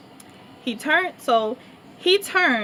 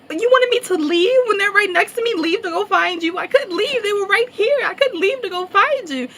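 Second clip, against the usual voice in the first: high, louder protest; defensive retort; 10 semitones higher, 4 dB louder than usual. Retold or imitated. Defending herself to her friend, high protest. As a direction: argue back, pitched up. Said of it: "And I'm like".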